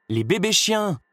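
insisting harder. A narrator's reading. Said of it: "insista Cruella".

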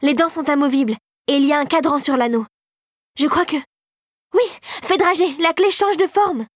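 high, mounting excitement of discovery; exclaiming. A narrator's reading. Girl explains the key to the fairy, exclaiming. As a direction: think aloud, rising to a high thrilled finish. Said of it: "s'écria-t-elle".

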